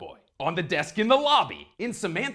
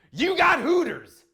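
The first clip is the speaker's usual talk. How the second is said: shouted high and slow, like an announcer; hyped shout; 10 semitones higher, 6 dB louder, slower than usual. Acted. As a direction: sell it, slow and loud. Joking, hyping Hooters.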